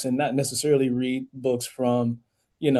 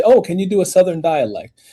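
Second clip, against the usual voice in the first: imitated asker, raised and louder; mock-eager question, pitched up; eager request; 5 semitones higher, 7 dB louder than usual. People asking a narrator for a dialect, eager request.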